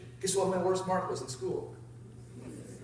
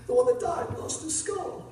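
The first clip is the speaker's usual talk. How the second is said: mock tearful trucker; 10 semitones higher, slower than usual. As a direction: squeak it out as the weeping big man. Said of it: "this big dude starts crying".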